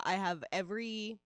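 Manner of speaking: normal talking voice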